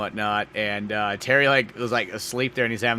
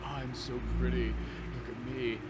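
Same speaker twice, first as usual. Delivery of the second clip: pretty guy; 7 dB quieter than usual. Retold or imitated. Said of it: "like, the pretty guy".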